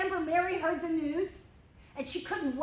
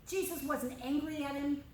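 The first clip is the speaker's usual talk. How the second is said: ordinary talk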